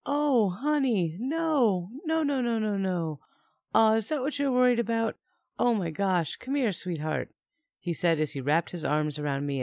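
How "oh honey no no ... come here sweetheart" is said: lightly voiced boyfriend, higher, coaxing; a hint of a smooth-talking man calming his girlfriend; soothing boyfriend. Narrator voicing Mike soothing.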